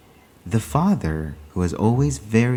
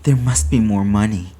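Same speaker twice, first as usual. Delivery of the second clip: insistent whisper; 4 semitones higher, 7 dB louder than usual. A narrator's reading. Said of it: "It came whispering".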